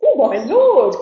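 high, very loud acted protest; exclaiming cry. Madman to Lord Orville, loud excited cry. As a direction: cry out with swooping emphasis. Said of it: "cried the madman".

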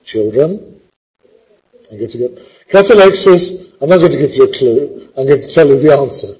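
ordinary talk, nothing marked

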